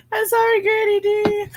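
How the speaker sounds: sorry through real laughter; laughing apology. Real, not acted.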